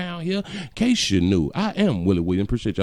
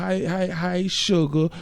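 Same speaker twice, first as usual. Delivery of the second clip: ordinary delivery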